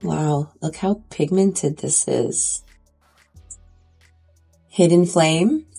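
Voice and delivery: ordinary delivery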